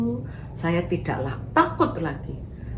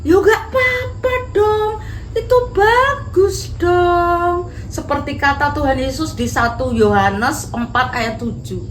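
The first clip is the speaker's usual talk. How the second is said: smiling Dinda; 9 semitones higher, 4 dB louder than usual. Acted. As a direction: reassure her friend, full of joy. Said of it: "sambil tersenyum-senyum dia bilang gini".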